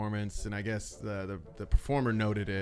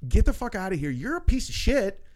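angry retort; 7 semitones higher than usual. Retold or imitated. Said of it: "it's just like".

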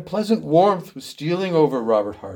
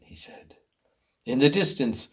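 ordinary delivery

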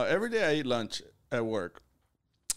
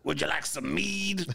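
gravelly man voice; 2 semitones higher than usual. Pretend. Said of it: "Should I just talk like a gravelly man?".

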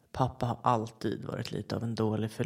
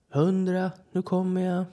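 loud, higher call across the woods; 5 semitones higher, 7 dB louder than usual. A narrator's reading. Dad calling to hidden kids, loud and high. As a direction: shout it so the hiders hear. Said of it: "Jag hör hur pappa ropar".

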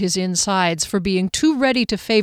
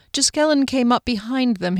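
ordinary delivery

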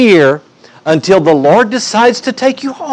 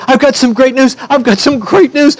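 high-pitched, excited announcement; giddy, high-pitched acted excitement; all excited; 8 semitones higher than usual. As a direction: burst out with it, jumping for joy. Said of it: "She's just all excited".

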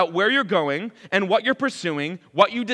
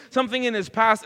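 usual speaking voice

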